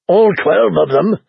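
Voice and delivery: plain reading voice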